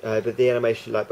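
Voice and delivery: ordinary talk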